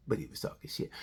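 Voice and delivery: hushed whispered aside. Real, not acted. Telling viewers the truth, whispered aside. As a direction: drop to a conspiratorial whisper. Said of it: "confiding aside".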